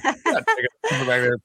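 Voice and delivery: mock scolding in a valley girl lilt; a hint of Valley Girl teasing; Valley Girl impression. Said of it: "a Valley Girl impersonation".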